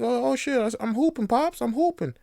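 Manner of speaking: put-on hyped little kid bragging; high, hyped child voice; excited kid voice. Six-year-old voiced hyped.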